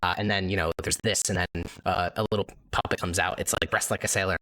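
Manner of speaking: ordinary talk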